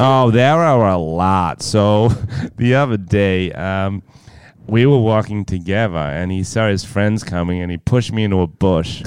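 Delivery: lightly put-on American girlfriend voice; American girlfriend impression. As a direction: gush as an imitated American girlfriend. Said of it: "impersonate his mysterious American girlfriend".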